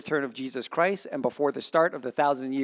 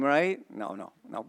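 usual speaking voice

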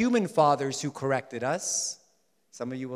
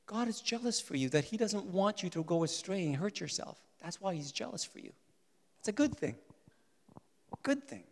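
very soft, higher voice; hushed reassurance; 3 semitones higher, 9 dB quieter than usual. Real, not acted. Preacher explaining God is jealous for you, hushed. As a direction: reassure them softly, almost whispering.

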